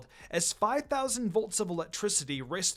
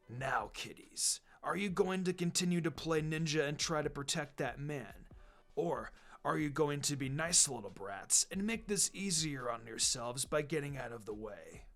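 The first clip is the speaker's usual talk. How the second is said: menacing villain; 4 semitones lower, 6 dB quieter than usual. Acted. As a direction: talk down to the kids, menacing. Zabuza threatens the kids.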